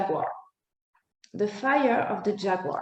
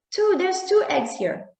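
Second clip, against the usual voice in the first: higher excited call from up a tree; 9 semitones higher than usual. Acted. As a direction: call down from the treetop. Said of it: "he called down to his brother".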